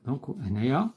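normal talking voice

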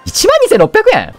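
high, slow disbelieving squeal; stunned price shock. Real, not acted. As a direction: gasp out the amount in disbelief. Shocked at a big payout.

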